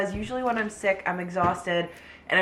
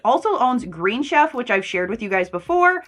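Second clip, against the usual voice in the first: louder upbeat ad read; 3 semitones higher, 7 dB louder than usual. Acted. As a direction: sell it like a sponsor spot. Sponsored ad read.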